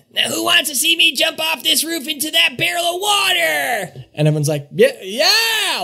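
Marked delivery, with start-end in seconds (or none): 0.1-4.1 high, strained shout from a rooftop; crazy daredevil yelling to crowd. Acted. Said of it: "And he's going". 4.7-5.8 put-on high, drawn-out drunken cheer; drunk crowd screaming. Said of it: "all the drunk people are kinda screaming".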